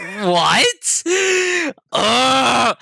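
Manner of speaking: loud, high exasperated yell; frustrated outburst. Retold or imitated. Retelling his reaction to mom's answer, loud exasperated groan. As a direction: throw up your hands in frustration. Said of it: "And I'm like".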